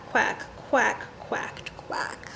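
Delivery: quacks fading from soft to a whisper. Acted. Reading to children, quacks fading into a whisper. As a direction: let the quacks die away into a whisper.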